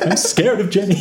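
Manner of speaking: a hint of a scared sad sack; timid Gil voice. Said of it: "and he's like".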